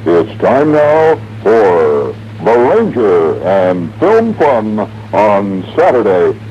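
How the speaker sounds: slow, drawn-out TV announcer; staff announcer. Retold or imitated. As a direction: announce the show with stretched words. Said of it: "the announcer off stage".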